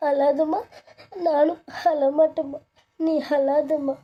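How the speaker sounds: high, tearful consoling; acted tearful plea, pitched high. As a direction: comfort your mother while holding back your own tears. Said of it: "நீ அழாதடி".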